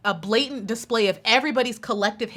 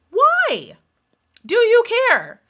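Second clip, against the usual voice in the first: much higher, disbelieving questions; high incredulous why; 11 semitones higher, 5 dB louder, much slower than usual. Real, not acted. To Callie about the drama, high incredulous questions. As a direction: squeak it out in disbelief.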